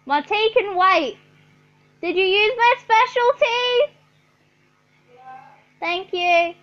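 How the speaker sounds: ordinary talk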